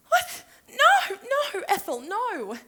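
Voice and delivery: very high, loud protest; hammed-up pleading panic; panicked denial. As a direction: plead frantically as if caught cheating. Frantic denial to jealous friend.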